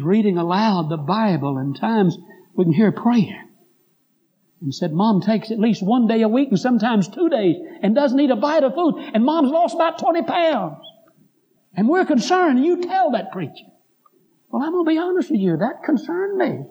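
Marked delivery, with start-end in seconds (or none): 4.9-13.6 high-pitched, mounting retold complaint; upset complaint. Daughter sending a warning to that preacher, acted-out concern. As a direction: imitate a concerned daughter, climbing higher in pitch.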